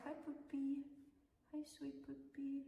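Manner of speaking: high soft coaxing pet voice; talking to a dog. Real, not acted. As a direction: sweet-talk the puppy closer. Greeting puppy softly.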